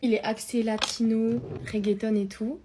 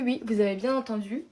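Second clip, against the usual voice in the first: ordinary talk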